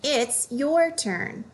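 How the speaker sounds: it's YOUR turn, slow and pointed; stress on your. Shown as a demonstration. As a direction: lift "your" high, then fall.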